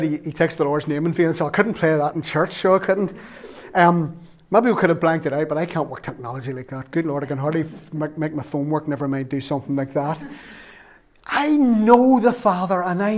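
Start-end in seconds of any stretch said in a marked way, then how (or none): none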